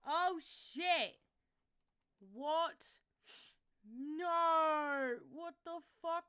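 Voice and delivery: high, loud alarmed shouts; real panicked yelling. Real, not acted. Streamer discovering broken chat display, panicked shouting. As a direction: yell in genuine dismay at the broken screen.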